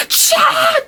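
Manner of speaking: very high mock shriek; shocked shriek. Reader imagines her reaction to a cliffhanger ending, shocked shriek. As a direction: shriek one wordless burst of shock.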